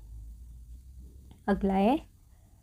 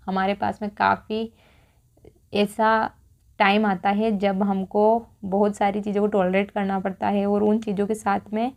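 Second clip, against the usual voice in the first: ordinary talk, nothing marked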